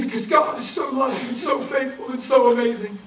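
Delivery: put-on gushing young woman. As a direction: gush about God like a devout girl. Woman's answer voiced high.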